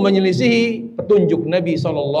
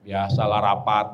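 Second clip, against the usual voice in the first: mumbled husband excuse; 11 semitones lower, 6 dB quieter than usual. Retold or imitated. Husband's low reply.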